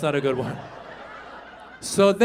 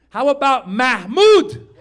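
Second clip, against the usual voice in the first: slow proud name pitch; 4 semitones higher, 7 dB louder, slower than usual. Retold or imitated. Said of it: "I go".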